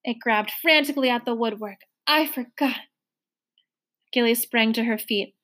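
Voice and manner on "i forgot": fading moan. A narrator's reading. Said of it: "it was moaning as it swayed".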